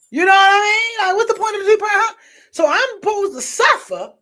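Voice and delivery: loud, fast cry of frustration with a break in the voice; anguished outcry. Real, not acted. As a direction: let it burst out as a near-cry of frustration. Outburst over suffering for a man.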